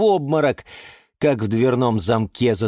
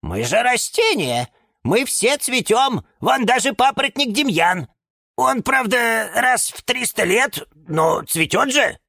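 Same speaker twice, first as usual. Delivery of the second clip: high, emphatic protesting; affronted little character making a case; indignant; 13 semitones higher than usual. A narrator's reading. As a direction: argue the point with high-pitched heat. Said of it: "возмутился Лимон Филимон".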